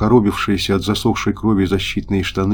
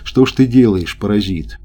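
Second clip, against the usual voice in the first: usual speaking voice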